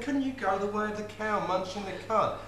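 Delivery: put-on high pleading boy's plea; pleading grandson. Grandson pleading with his granny not to go, high and pleading. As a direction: beg her like a little boy, high up.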